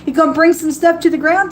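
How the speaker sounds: usual speaking voice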